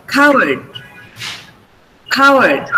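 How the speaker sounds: "Coward" with a puff of air on the k; aspirated k. Shown as a demonstration. Teacher correcting a student's word, aspirated k in "Coward". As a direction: release the first k of "Coward" with audible breath. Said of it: "so it is aspirated".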